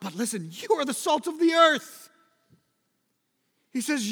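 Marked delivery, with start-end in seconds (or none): 0.5-2.1 high, loud, ringing declaration of the verse; loud proclamation. Acted. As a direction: call it out to the whole church. Preacher proclaims Jesus' words.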